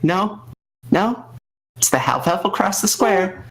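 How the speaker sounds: talking into cupped hand; hand over mouth. Acted. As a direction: speak through your hand. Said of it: "It's like".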